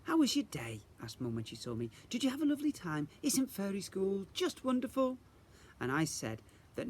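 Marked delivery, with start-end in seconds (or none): 0.0-5.2 higher, bright motherly voice; lightly voiced mother hoping for good news; cheery Mum. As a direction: ask eagerly and sweetly. Mum greets her brightly.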